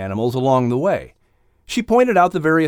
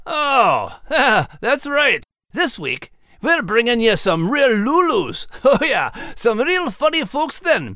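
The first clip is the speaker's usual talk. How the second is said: high, cheery Scottish brogue; lilting Scottish accent, pitched high; Scottish terrier voice; 8 semitones higher than usual. Acted. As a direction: play a proud little Scottish dog. Max voice held.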